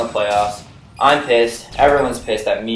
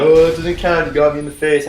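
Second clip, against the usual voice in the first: put-on higher, louder whiny-kid complaint; mock indignant protest; 5 semitones higher, 5 dB louder than usual. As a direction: imitate a kid disputing the hit. Acted protest at being hit.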